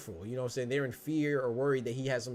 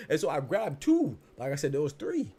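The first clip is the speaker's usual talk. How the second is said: normal talking voice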